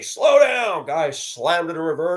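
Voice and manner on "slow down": high, loud slow down; shouting at the speeding driver; yelled warning. Retold or imitated. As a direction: call it out sharply at the car.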